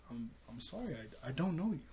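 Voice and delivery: soft, stammering brush-off; acted-out timid apology; hesitant apology. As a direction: play a quiet, awkward stranger. Voicing Terra not recognizing him.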